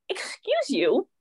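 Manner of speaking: high, drawn-out offended question; indignant. Retold or imitated. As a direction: retell an affronted comeback, slowly. Retold indignant comeback to prying ex.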